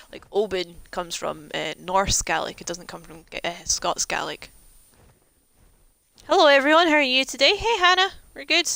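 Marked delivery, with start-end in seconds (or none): 6.3-8.7 excited greeting. Real, not acted. Greeting chat on stream.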